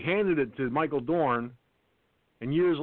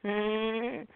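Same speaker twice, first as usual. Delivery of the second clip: Peter Griffin laugh; 8 semitones higher than usual. Retold or imitated. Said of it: "you know Peter Griffin".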